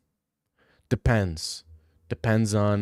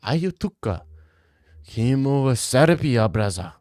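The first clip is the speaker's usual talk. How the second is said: broken-up bad Dagestani accent with a lisp; unsure mock-Dagestani attempt; halting Dagestani accent. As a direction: feel out a Dagestani accent phrase by phrase. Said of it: "like, a Dagestani with, like, a speech impediment".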